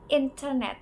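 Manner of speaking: "internet" with the T said clearly; crisp T in "internet"; clear British T. Shown as a demonstration. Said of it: "The T in internet is often pronounced clearly".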